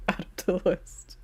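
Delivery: soft laughing whisper; a whispered repeat through a laugh; whispered echo. Real, not acted. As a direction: breathe the line half to yourself. Echoing the idea list joke.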